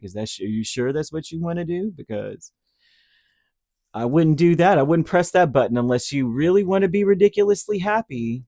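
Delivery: sarcastic Spirit. Pretend. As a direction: tease them like a smug trickster. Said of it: "they're being very sarcastic with you".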